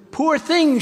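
high, pitying cry of a kind woman; tender woman's cry. Acted. Woman pities the frozen snake, high pitying cry. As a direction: coo with pity over a frozen creature. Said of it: "she cried".

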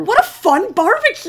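high, fake-delighted exclamation; over-bright sarcastic cheer. Real, not acted. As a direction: gush with mock enthusiasm. Sarcasm about a script-reading barbecue.

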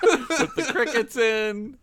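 laughing through the words. Real, not acted. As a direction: giggle it out. Laughing at silent response.

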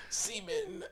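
very high, strained exclamation; absurdly high-pitched mock rapture; mock ecstatic cry. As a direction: squeal it out, hammed up. Mocks Thanos getting an Infinity Stone.

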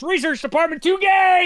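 high-pitched mock announcement rising to a shout; mock outraged shout. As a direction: blurt it out, climbing to a high yell. Mock research verdict shouted out.

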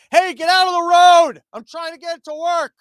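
high, loud, hammed-up yell at a truck; mock road-rage yell. Trucker in a car yells at his own parked truck, mock road rage. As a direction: bellow it like a furious commuter stuck behind a truck.

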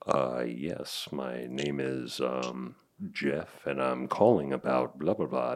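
low, soft, mellow phone voice; hushed, deep and unhurried; calm and mellow. Retold or imitated. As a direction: imitate yourself being super calm on a call. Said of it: "I was just super calm and mellow".

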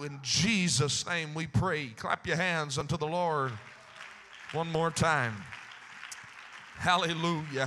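loud preacher prayer; fervent worship leading. Acted. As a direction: lead the church in praise. Leads public prayer.

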